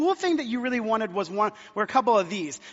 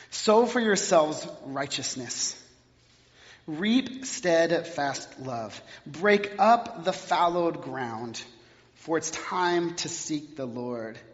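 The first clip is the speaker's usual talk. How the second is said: lower, slow, solemn Bible reading; scripture reading; 5 semitones lower, slower than usual. Acted. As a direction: read the verse slowly and gravely. Reads scripture aloud.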